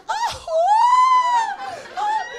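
very high loud mock cries while dancing; high shrieking moans. Dancing wildly with a guy at the disco, high mock shrieks. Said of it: "bailáis con el gallo así".

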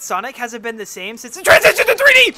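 loud, fast mock rant. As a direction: rant like a nerd. Mock rant about Sonic.

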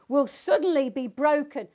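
"suddenly" given firm emphasis; stress on suddenly. Shown as a demonstration. Preacher reading the proverb's warning, stress on "suddenly". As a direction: lean on the word "suddenly". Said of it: "the emphasis is on the word suddenly".

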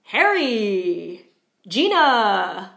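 loud sing-out of names, last syllable stretched; calling out. Shown as a demonstration. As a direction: call to friends, drawing out each name. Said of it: "calling out to your friends".

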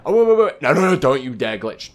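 loud, high burst of panic; alarmed. Real, not acted. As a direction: yell out to stop it happening. Fears the game will glitch.

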